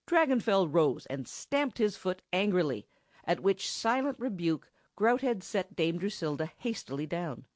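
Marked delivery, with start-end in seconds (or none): none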